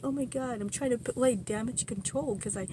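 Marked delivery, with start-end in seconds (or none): 0.0-0.6 higher, louder Oh my God; alarmed exclamation. Retold or imitated. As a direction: blurt it out in alarm. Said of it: "I'm going".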